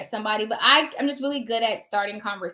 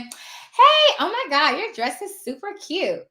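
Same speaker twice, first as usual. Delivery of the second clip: much higher, loud burst on hey, then slowing; gushing warmth; 5 semitones higher, 3 dB louder, much slower than usual. Shown as a demonstration. Notary warmly complimenting the receptionist's dress, gushing. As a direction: gush over her like a bestie, slow on the compliment. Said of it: "and then someone coming in".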